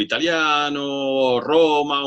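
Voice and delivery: slipping into an Italian accent; Italian lilt on fútbol italiano. Real, not acted. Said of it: "te sale acento italiano cuando dices el fútbol italiano".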